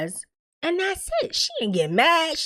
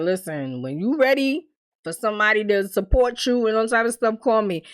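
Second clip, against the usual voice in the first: ordinary talk, nothing marked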